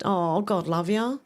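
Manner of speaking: slow, drawn-out, rising sympathetic sigh of words; tender sympathy. Real, not acted. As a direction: soothe a lonely friend. Sympathetic reply to a lonely viewer.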